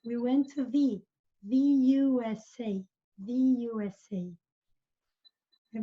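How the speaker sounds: "the" said as "thee"; slow model of "the U S A" with "the" rhyming with "see"; "the" before vowels. Shown as a demonstration. Teacher showing the article before a vowel, "the" as "thee". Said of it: "ele é pronunciado the".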